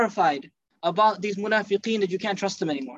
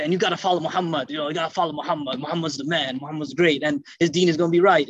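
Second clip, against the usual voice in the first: mock fake flattery; 6 semitones lower than usual. Hypocrite's fake praise acted.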